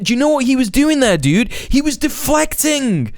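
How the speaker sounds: high-pitched, insulting tirade; exasperated rant. Real, not acted. Streamer berates commentator over misreading deflection, exasperated.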